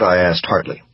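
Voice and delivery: ordinary delivery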